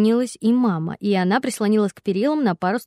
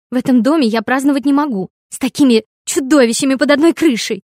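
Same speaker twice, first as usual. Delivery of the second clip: loud, higher, outraged daughter; indignant shouting; 5 semitones higher, 8 dB louder than usual. A narrator's reading. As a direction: fling it at him, raised voice. Daughter fights with father. (возмущённый крик)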